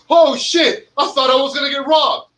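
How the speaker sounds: mock startled yelp. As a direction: imitate a rep jumping out of his skin. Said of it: "he bumped into them and he goes".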